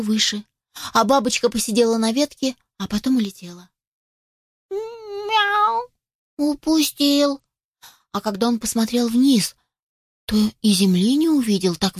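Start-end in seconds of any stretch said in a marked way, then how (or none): 4.7-7.4 high, drawn-out kitten mew; disappointed kitten. Acted. Kitten has lost the butterfly, disappointed.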